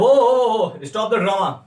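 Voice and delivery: loud "वो, वो" cutting off a show-off; teasing, loud whoa; mock exasperated shout. Shown as a demonstration. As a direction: stop the boaster mid-brag. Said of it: "तो अगला बोलता है".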